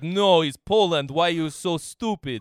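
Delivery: put-on loud, high, exasperated Slavic accent; hammed-up Russian-sounding Polish accent; mock Polish accent. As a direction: scold like an indignant Pole with a thick accent. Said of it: "they'd be like".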